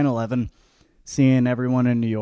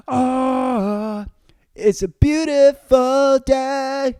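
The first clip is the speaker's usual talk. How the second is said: much higher, louder, slow and beaming; mock inspirational voice; 12 semitones higher, 6 dB louder, slower than usual. Host parodies an uplifting patriotic ad for listeners, beaming mock inspiration. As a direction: proclaim it like a cheesy uplifting ad.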